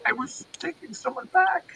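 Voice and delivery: hammed-up fear, slowing on the jail question; mock panic. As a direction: play the terrified traveler for laughs. Panicked reply to Russian guard.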